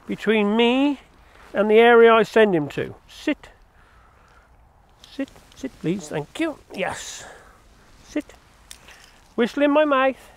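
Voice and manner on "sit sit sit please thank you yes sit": soft whispered sit to the dog; whispered command. Real, not acted. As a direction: breathe the command quietly.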